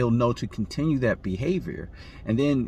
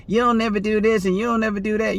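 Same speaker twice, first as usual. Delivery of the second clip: mock angry nagging, much higher, louder and fast; aggressive and angry; 10 semitones higher, 4 dB louder, faster than usual. Woman attacking her man with complaints, mock angry. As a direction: hammer the complaints like a combative partner. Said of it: "at the guy aggressive and angry".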